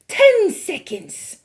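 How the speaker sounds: very high, loud witch cry. A narrator's reading. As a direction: shriek the countdown gleefully. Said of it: "cried the Grand High Witch".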